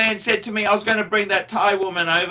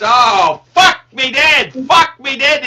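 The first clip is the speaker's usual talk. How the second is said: loud, higher exclamation; 5 semitones higher, 7 dB louder, slower than usual. Real, not acted. Telling mate about father's advice, loud exclamation. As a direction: blurt it out in disbelief.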